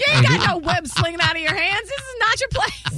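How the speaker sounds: high, teasing put-down; sassy friend scolding a would-be hero; mock scolding. Real, not acted. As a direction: rib him for playing the hero.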